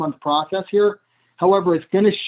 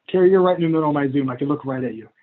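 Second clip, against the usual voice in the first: ordinary delivery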